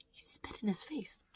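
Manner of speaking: whispered in disbelief; stunned whisper. Real, not acted. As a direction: breathe it out, shaken. Repeating in disbelief.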